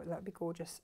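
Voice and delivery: ordinary talk, nothing marked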